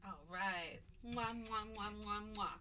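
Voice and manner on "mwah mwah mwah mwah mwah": blown kisses; between kisses. Real, not acted. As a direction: smack kisses into the mic. Blowing kisses at sign-off.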